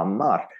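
"Amar" with two matching solid ah sounds; consistent A's. Shown as a demonstration. Teacher models for students, "Amar" with two equal ah's. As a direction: make both vowels of "Amar" the same clear ah. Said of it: "those two A's have to be as consistent".

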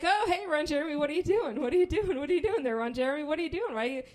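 high, loud, rapid-repeat questioning; comic panicked objection; alarmed protest. Retold or imitated. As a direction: babble in flustered alarm, trying to sound polite. Startled by hand down shirt.

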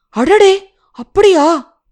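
high, astonished exclamation; surprised reaction. Acted. Fawn reacting to the rabbit's warning, surprised. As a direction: react with surprise, pitch jumping up.

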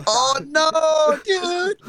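high, alarmed "oh no, dude"; panicked dread at the reveal; horrified. Real, not acted. Dread at the screen share.

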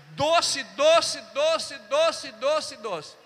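loud, high repeated "Doce"; insistent declaration. Acted. Pastor telling the tall woman she is sweet, loudly. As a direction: keep pressing the word "doce" on her.

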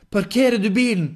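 high, very loud sudden outburst; involuntary blurt. Acted. Henrik's mouth speaking by itself, shouted blurt. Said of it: "Kom det ut av Henrik".

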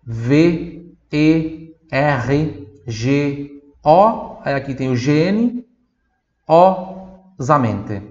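letters spelled very slowly; slow spelling. Shown as a demonstration. Teacher spells vergonhosamente slowly for students. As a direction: spell it out for the class. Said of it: "spelling letters".

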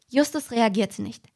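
normal talking voice

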